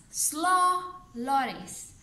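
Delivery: slow loris said slowly and drawn out. Shown as a demonstration. Teacher saying slow loris slowly for the class. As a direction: stretch out slow loris like its name.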